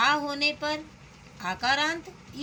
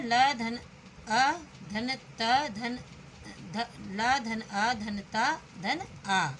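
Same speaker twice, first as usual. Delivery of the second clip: slow spelling out; much slower than usual. Shown as a demonstration. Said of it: "इसका वर्ण विच्छेद है".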